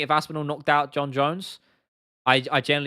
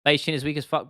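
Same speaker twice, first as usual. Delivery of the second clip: ordinary delivery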